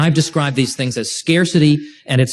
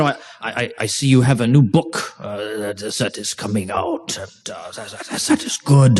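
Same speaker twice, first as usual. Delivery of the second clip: halting, softer German-accented imitation; 4 semitones lower, 4 dB quieter than usual. Lecturer imitates a German scholar asking about his new book, German accent. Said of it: "So that's my best German, German accent I can do".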